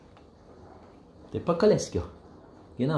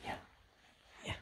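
ordinary talk

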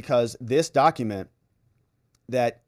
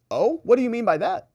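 high, rising challenge; mock suspicion; 9 semitones higher than usual. Lawyer challenging Carroll on her oh, suspicious. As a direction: pounce on it with a high questioning lilt.

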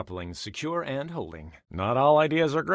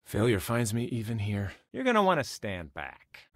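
ordinary talk, nothing marked

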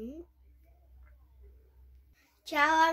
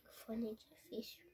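whispering; 2 semitones lower, 15 dB quieter, faster than usual. Real, not acted. Child struggling with doll's headphones.

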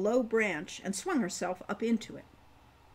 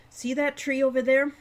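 calling out; 5 semitones higher, 9 dB louder than usual. A narrator's reading. Calling over to her.

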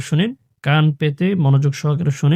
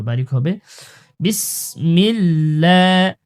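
ordinary talk

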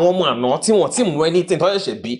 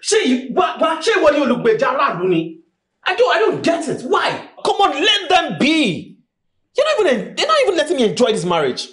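much higher angry shouting; angry outburst; 10 semitones higher than usual. Acted. Furious husband shouting at his apologising wife. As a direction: rant at her in exasperation.

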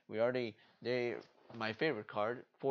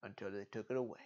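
ordinary talk

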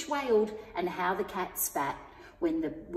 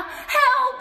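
loud, very high scream of Help!; cry for help; 8 dB louder than usual. A narrator's reading. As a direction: scream it as the dragon chases her. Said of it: "cried the witch".

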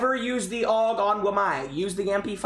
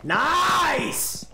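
excited; 8 semitones higher than usual. Real, not acted. Impressed by a clean kill.

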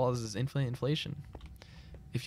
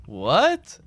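loud, rising yelp of disbelief; incredulous; 3 semitones higher, 7 dB louder than usual. Real, not acted. Gamer who lost sight of his opponent, incredulous. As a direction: let it jump up in disbelief. Opponent vanished during a duel.

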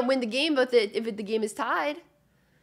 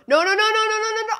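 much higher and louder, rapid protest; panicked no; 11 semitones higher, 10 dB louder, faster than usual. Real, not acted. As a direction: beg the puck not to go in. Shot heading at own net.

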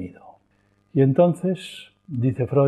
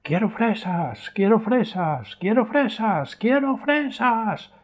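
put-on high, slow whiny child wailing the same demand; a mock tantrum climbing higher with each repeat; growing whine of a spoilt boy; child's tantrum; 11 semitones higher, slower than usual. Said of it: "entra en una rabieta interminable".

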